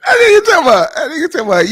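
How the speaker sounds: squeaky, high, loud words through helpless laughter; laughing hard. Real, not acted. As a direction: barely get it out for laughing. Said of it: "this shit had me dying laughing".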